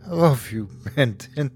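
giggling. A narrator's reading. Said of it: "she giggled".

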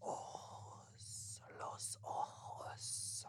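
slow eerie whisper. Pretend. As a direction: hiss it like a ghost.

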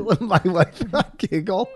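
giggly, high teasing echo; laughing through a mock sweet line. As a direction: tease her by singing your own phrase back while laughing. Teasing her, echoing own line.

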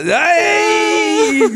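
high, drawn-out "hey!"; hammed-up Italian cry; classic Italian caricature. Old Giuseppe comes outside, mock Italian holler. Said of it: "I did the classic Italian".

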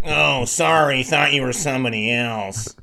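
a light sulky sperm voice; pouty voice. Retold or imitated. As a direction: whine it like a sulking little guy. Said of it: "Just real pouty voice".

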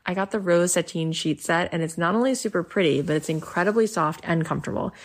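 upbeat sponsor read. Acted. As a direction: read it like a podcast ad. Host reads sponsor ads.

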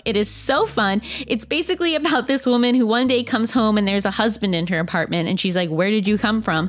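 upbeat sponsor read, clear and even. Acted. Host reads a book club ad to listeners, upbeat ad read. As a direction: sell the book club like a friend.

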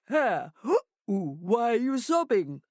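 lightly voiced hooting monkey. Monkey asks sobbing Hare, in a hooty monkey voice.

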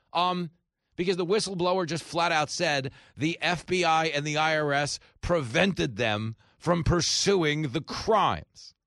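loud, high, emphatic comeback; exasperated rebuttal. Real, not acted. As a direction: spell it out loudly, as if to someone slow. Exasperated rebuttal to the mocked pundits.

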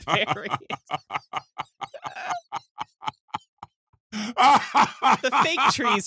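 talking through real laughter; giggling while talking; laughing. Real, not acted.

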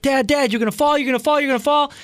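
high, panicky kid imitation; freaking-out daughter. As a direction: imitate a worried child warning Dad over and over. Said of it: "my daughter is freaking out".

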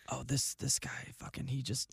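very soft, slow, halting whisper. Acted. Moviegoer filling in a friend mid-film, whispering. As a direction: fumble through a hushed explanation. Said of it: "You can whisper".

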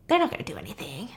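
whispered, fast and teasing; taunting whisper. Acted. Avenger taunting the dean about her daughter in a whisper. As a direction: breathe it like a cruel secret.

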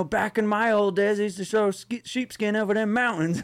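a mock old-timer reminiscing; a hint of an old-timer spinning yarns. Mimics someone living in the past.

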